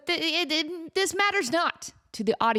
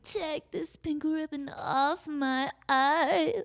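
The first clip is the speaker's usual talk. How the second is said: slow, drawn-out line with a wobbly vibrato; 4 semitones higher, 3 dB quieter, much slower than usual. Retold or imitated. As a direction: stretch the words out with a heavy vibrato. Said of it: "she does this really, really weird vibrato thing".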